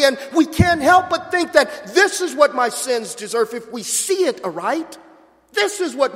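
loud, high, building sermon climax; preaching at full force. Real, not acted. Preacher urging brothers and sisters, fervent full-force preaching. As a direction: preach it out, swelling with fervour.

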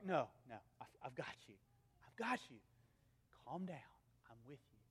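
kneel down and soothe them; gentle father calming kids. Acted. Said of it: "gets on one knee and says".

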